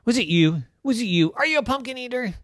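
high, insistent questions; accusing questioning. Acted. Rose interrogating daddy, accusing.

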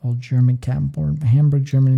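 ordinary talk, nothing marked

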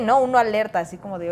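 ordinary delivery

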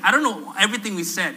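ordinary delivery

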